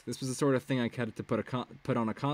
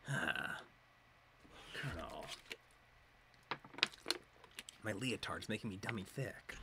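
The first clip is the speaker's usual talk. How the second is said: low, much softer gruff murmur; deadpan gruff parody over comms; Snake voice; 3 semitones lower, 14 dB quieter than usual. Pretend. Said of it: "I have to do the Snake voice".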